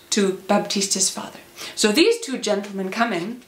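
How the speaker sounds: ordinary delivery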